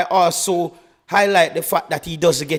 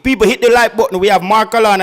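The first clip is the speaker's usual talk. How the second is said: loud call-out; 4 semitones higher, 7 dB louder, faster than usual. Real, not acted. Host calls out to viewers.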